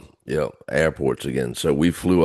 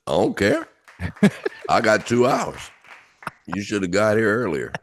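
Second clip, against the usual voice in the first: laughing; 5 semitones higher than usual. Retold or imitated. Laughing refusal to line cutter.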